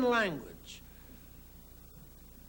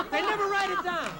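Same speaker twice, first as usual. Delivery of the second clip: ordinary delivery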